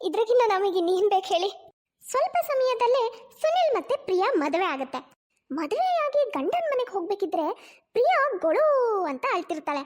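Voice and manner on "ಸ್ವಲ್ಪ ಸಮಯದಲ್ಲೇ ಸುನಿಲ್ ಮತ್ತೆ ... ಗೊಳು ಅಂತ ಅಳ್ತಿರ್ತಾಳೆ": higher-pitched, lilting storyteller voice; story narration. A narrator's reading. Storyteller moving on to the wedding, lilting narration. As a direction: narrate with big rises and falls.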